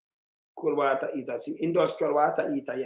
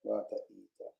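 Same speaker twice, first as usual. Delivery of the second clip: whispered; 9 semitones lower, 14 dB quieter than usual. Real, not acted. Reading to himself.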